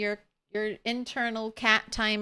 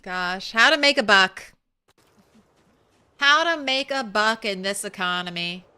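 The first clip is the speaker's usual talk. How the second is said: ordinary talk, nothing marked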